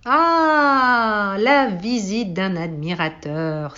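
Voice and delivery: loud, long-drawn delighted "Ah"; calling from afar. A narrator's reading. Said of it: "s'écria de loin le vaniteux".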